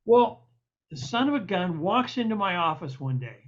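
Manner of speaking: ordinary talk, nothing marked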